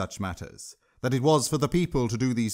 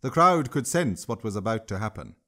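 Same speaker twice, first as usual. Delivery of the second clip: ordinary delivery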